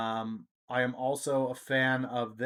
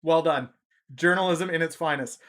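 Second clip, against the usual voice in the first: louder, mock-impressed jab; sarcastic praise; 4 semitones higher, 7 dB louder than usual. Streamer mocking a journalist who withholds exit polls, sarcastic. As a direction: deliver it as loud mock applause.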